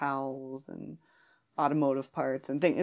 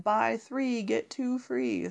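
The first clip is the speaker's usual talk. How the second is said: ad slogan voice; 5 semitones higher, much slower than usual. Retold or imitated. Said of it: "where it's like".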